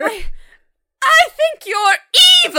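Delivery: mock accusation. Mocking the mother's line.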